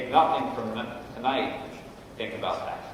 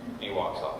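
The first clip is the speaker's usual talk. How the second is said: normal talking voice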